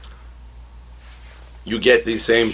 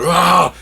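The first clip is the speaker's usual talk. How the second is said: high, loud mock war yell; imitated attacking roar; battle cry; 9 semitones higher, 6 dB louder than usual. Barda jumps out of the boom tube, mock battle yell. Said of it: "she goes".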